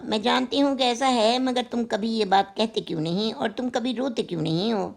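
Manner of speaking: ordinary delivery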